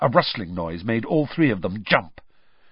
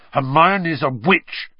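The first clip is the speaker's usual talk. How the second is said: louder, higher, drawn-out angry retort; snarling; 5 semitones higher, 6 dB louder, slower than usual. A narrator's reading. Harry defends Hermione against Malfoy, snarling. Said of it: "Harry snarled".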